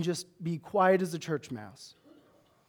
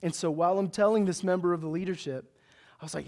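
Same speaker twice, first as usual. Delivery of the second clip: usual speaking voice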